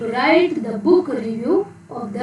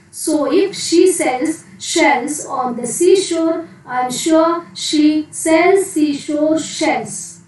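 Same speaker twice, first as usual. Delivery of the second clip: slow, raised, each word spelled out; slow careful tongue twister; 3 semitones higher, slower than usual. Shown as a demonstration. Teacher models a tongue twister for children, slow and careful. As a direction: lay out every sh sound slowly for the class. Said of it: "Once again".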